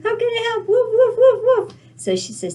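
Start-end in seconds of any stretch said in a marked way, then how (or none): 0.0-0.7 high-pitched eager dog voice; talking dog. Acted. Dog answering the little girl's call, high doggy voice. 0.7-1.7 high, loud playful woofs; barking dog. Acted. Eager dog answering the little girl's call, loud high barking.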